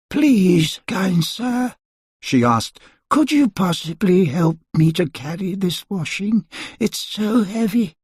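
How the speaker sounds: put-on an old woman asking for help; old washerwoman voice. A narrator's reading. Washerwoman asking Mister Stingy for help, pleading.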